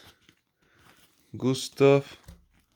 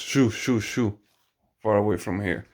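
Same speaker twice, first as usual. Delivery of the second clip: ordinary talk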